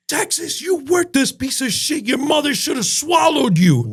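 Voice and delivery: high, furious mock yell at a kid; imitating the chef screaming insults; retold chef's yell. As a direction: scream it down like an abusive head chef. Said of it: "he was yelling at some kid".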